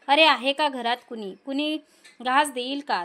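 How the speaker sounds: ordinary delivery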